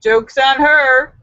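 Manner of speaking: ordinary talk, nothing marked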